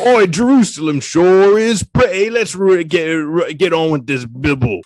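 broad, hammed-up English accent; obnoxious English accent. English Bible writers chatting in Jerusalem, obnoxious English accent. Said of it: "They were an obnoxious English accent".

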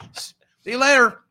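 high, loud sarcastic farewell; mocking send-off. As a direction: wave them off with a sneering sing-song. Mocking send-off after waving goodbye.

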